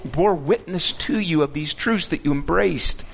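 bewildered, pleading questions; perplexed. Acted.

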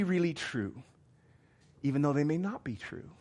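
ordinary talk

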